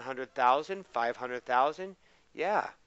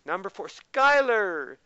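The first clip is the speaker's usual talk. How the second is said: usual speaking voice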